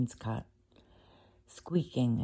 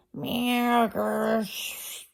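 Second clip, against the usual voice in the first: higher meow, growl, then a hiss; a hammed-up prowling cat at night; strange cat noises; 8 semitones higher, much slower than usual. Simpkin answering the sleeping tailor, meow growl and hiss. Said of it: "made strange, horrid noises".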